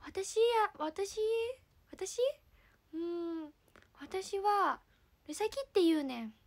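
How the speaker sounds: drawn-out, exaggerated Kansai lilt; pretend Kansai dialect. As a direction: fumble a self-introduction in fake Kansai dialect. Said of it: "じゃあ関西弁ね。".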